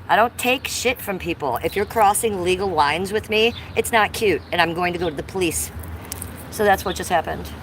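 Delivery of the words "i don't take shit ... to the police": ordinary talk, nothing marked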